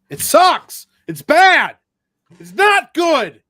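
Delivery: loud, high, exasperated ranting; shouting that it's not good, slowing on the last line; angry outburst. Real, not acted. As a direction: blast the show, fed up and loud. Angry rant about the show.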